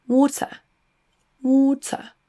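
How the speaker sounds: British "water", crisp t, no final r; British accent, "water" ending in schwa. Shown as a demonstration. Teacher contrasts with American accent, British pronunciation. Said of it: "se prononce avec un son schwa à la fin".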